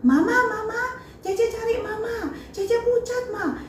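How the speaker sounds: high-pitched imitated cry for mother; retold child's yell. Child calls Mama about pale sister, retold high yell.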